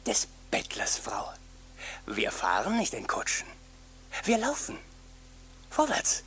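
soft whispered order; hushed command. Acted. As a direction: hiss it under your breath. Beggar hushes his wife.

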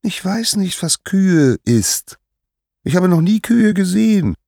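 put-on very high, childlike protest; high-pitched mock-innocent denial; innocent little Hermes. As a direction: play innocent, stretching the unknown word.

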